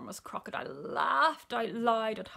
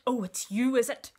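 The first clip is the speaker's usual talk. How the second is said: louder, higher, affronted elephant; indignant recognition; 3 semitones higher, 7 dB louder than usual. A narrator's reading. Bitten elephant recognises the crocodile, loud and indignant. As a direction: pounce on the culprit indignantly.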